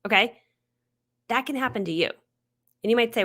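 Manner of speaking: ordinary talk, nothing marked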